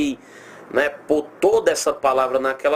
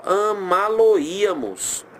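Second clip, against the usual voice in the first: "amaloíamos" slowly, syllable by syllable, stress on Í; joining the parts; 3 semitones higher, much slower than usual. Shown as a demonstration. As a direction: stretch out "amaloíamos" as pieces being joined. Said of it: "Aí você junta aqui".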